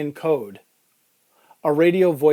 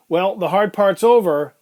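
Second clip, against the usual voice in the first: higher, louder, lightly acted paramedic; lightly voiced paramedic; 4 semitones higher, 6 dB louder than usual. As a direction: lift the voice a little, louder. Paramedic speaks to strapped patient.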